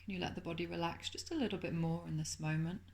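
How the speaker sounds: soft, calm meditation voice with lingering pauses; guided meditation. Acted. Teacher settling listeners into Yoga Nidra, soft guided meditation.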